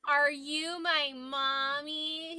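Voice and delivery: slow, drawn-out whiny mock of the rude caller. Support worker mimics belligerent caller, slow whiny mock. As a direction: stretch it out like a sulky overgrown kid. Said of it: "He said it like that".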